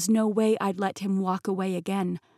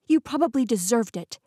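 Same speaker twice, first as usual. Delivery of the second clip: sharp, higher, louder retort; spat back; 4 semitones higher, 5 dB louder than usual. Acted. Heroine snapping at Peter over Tate, louder spat retort. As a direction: bite the words back at him. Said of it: "I spat back".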